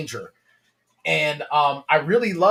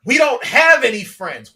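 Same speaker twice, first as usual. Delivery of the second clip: retold complaint; 7 semitones higher, 6 dB louder than usual. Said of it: "And he goes".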